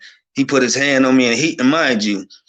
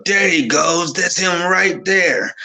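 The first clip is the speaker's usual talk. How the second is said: retold yell; 6 semitones higher, slower than usual. Spotting a guy round the corner, high retold yell. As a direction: call it out high and stretched. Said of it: "I just yell".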